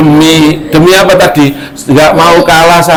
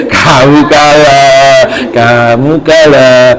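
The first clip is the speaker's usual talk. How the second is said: slow, triumphant "kamu kalah"; gloating; much slower than usual. Acted. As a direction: drag out the victory slowly. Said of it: "Nasruddin baru ngomong".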